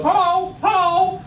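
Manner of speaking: high parrot hello. Acted. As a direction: squawk it like a talking parrot. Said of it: "And the parrot goes".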